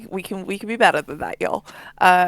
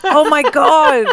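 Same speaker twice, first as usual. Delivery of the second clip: excited; 7 semitones higher, 6 dB louder, slower than usual. Real, not acted. Excited reaction to the band name.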